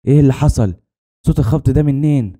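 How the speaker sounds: worried husband pressing for answers; anxious urgency. Acted. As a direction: ask urgently, pitch lifted with worry. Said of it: "سألتها بلهفة".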